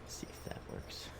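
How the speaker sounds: soft whispered aside to himself; hushed, half to himself, waiting to see. Real, not acted. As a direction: breathe it like a secret test. Hushed aside while testing party.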